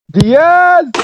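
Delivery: high, loud cry of the player's name; excited shout. Real, not acted. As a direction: yell the name as he breaks through. Goal chance, yelling player's name.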